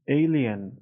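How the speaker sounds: normal talking voice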